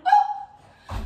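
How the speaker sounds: high, loud laughing oh; laughing surprised oh. Real, not acted. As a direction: squeal in playful surprise. Surprised squeal mid-exercise.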